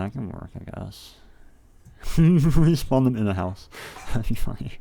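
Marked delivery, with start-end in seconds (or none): 2.0-4.7 laughing through a silly idea, louder. Real, not acted. Streamer pitching a silly respawn idea, laughing louder. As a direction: crack yourself up at the joke.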